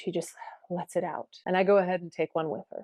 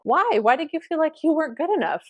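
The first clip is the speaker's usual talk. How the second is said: high, loud questioning why; puzzled concern; 9 semitones higher, 5 dB louder than usual. Retold or imitated. Teacher questioning student's self-doubt, puzzled and concerned. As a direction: ask it high, as if truly puzzled.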